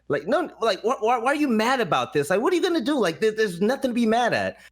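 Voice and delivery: high, rising disbelief, pleading with him; why are you mad, voice climbing; incredulous pleading. Retold or imitated. To the angry player, high incredulous pleading.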